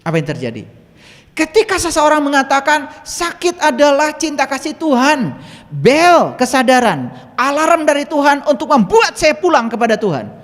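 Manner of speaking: high, loud proclamation; impassioned preaching. Real, not acted. Speaker proclaiming a healing to followers, impassioned preaching. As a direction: ring it out to the whole crowd.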